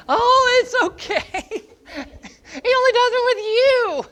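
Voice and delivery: mimicking mom in a high falsetto, dismissive; high mock-mom voice; mom's high voice. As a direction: squeak it out like a doting mom brushing it off. Mom's reply voiced in story.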